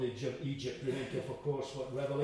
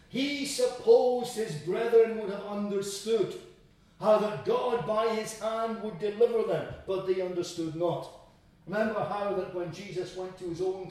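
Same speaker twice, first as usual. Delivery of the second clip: raised, emphatic pulpit voice; forceful preaching; 6 semitones higher, 7 dB louder than usual. Acted. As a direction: preach it out with weight on key words.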